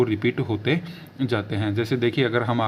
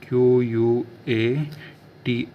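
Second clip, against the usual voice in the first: Q यू ए spelt slowly, letter by letter; slow dictation; slow spelling; much slower than usual. Shown as a demonstration. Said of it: "quattro".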